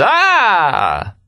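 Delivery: high, long held whoop; full-lunged "Ah". A narrator's reading. Remy beside himself at the remark, high whooping "Ah". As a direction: hold a high laughing "Ah", then let it drop. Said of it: "at the top of his lungs".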